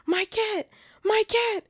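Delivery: very high, loud anguished shriek; shrieking caretaker. Acted. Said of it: "he shrieked".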